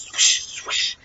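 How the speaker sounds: whispery imitation of a Doppler swoosh; voiceless rhythmic whooshes, imitating the monitor; heartbeat swooshing. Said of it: "then you just hear swoosh".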